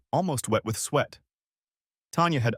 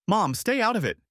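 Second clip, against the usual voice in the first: much higher, a touch louder; daughter snapping; 12 semitones higher, 3 dB louder than usual. A narrator's reading. Daughter telling mother to stay out, high. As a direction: snap back at mom.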